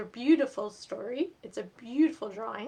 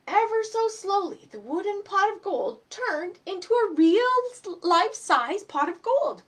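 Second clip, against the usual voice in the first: lively, higher storybook reading voice; reading to children; 6 semitones higher, 9 dB louder than usual. A narrator's reading. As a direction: read it aloud to little kids, bright and animated. Reads picture book to children.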